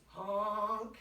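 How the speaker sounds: playful honk. Shown as a demonstration. Touching nose with kids.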